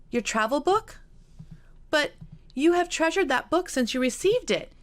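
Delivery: high, bright surprise; delighted disbelief at the gift; jubilant. Acted. Kitty to Elizabeth about the travel guide, jubilant. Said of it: "Kitty said jubilantly".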